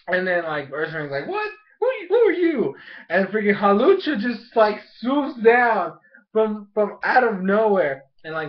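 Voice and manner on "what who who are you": very high, startled "who are you"; surprised monster. Retold or imitated. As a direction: caught off guard, shocked. Voicing Ursaring, surprised.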